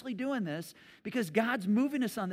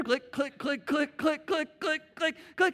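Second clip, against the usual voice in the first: click sound; 6 semitones higher, 4 dB louder, slower than usual. Retold or imitated. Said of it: "it's like".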